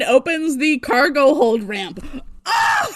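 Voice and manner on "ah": short, high, loud "ah!"; overwrought cry. Real, not acted. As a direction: cry out as the scene hits you. Reacting to the dramatic scene.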